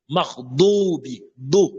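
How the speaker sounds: MAGHDUBI with a heavy rounded DO, drawn out; emphatic DO. Shown as a demonstration. Teacher correcting dou into do, slow heavy d. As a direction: stretch MAGHDUBI slowly, filling the mouth on DO. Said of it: "au lieu de do".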